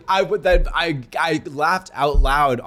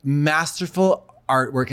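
ordinary delivery

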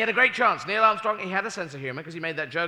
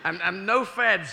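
high, loud, slow hostage relaying demands; lightly voiced hostage astronaut; relaying ransom demands; 5 semitones higher, 5 dB louder than usual. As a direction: nervously pass on the monster's ransom. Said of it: "he says".

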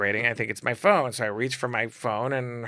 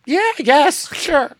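high, loud startled reply; caught off guard; 8 dB louder, slower than usual. Retold or imitated. Answering her blunt question, loud and high in surprise. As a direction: blurt it out, thrown by the question.

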